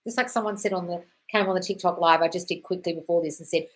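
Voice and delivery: normal talking voice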